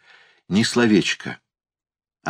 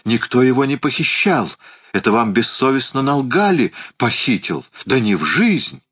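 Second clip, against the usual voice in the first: higher-pitched, heated denial; indignant yelling; 7 semitones higher than usual. A narrator's reading. Uncle denying he had David kidnapped, indignant yelling. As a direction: protest the slander with some heat. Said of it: "завопил дядя".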